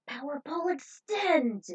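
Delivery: battle cry. Acted. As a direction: call the move like an anime hero. Ayame calls out her pole move.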